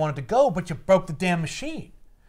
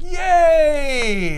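high, drawn-out hammed-up yay; mock cheer; 9 semitones higher, 4 dB louder than usual. April seeing the turtles back, drawn-out mock cheer.

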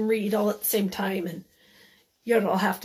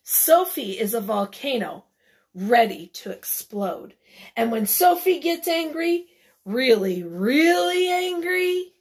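dramatic story reading; 2 semitones higher, 6 dB louder than usual. A narrator's reading. Reads building anger to children.

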